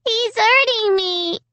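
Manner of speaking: put-on very high, slow child's wail; high drawn-out wail; wailing little girl. A narrator's reading. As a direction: whine it out in pain like a small child. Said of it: "Alicia wailed".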